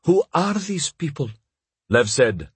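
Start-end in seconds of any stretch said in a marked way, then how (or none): 0.0-1.3 high, frightened character voice; higher-pitched, acted fright. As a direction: ask it in alarm, pitched well up. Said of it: "said in a frightened voice".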